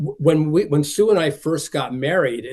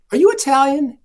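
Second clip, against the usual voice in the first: put-on old lady voice; 12 semitones higher, 8 dB louder than usual. Old lady's question retold.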